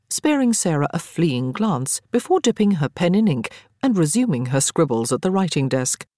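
usual speaking voice

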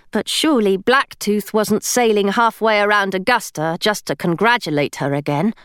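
ordinary delivery

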